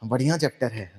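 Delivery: lightly voiced yes-sir student, low and soft; imitating agreeable students. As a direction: murmur it like a dutiful class agreeing. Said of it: "जैसे बोलते हैं ना सब लोग".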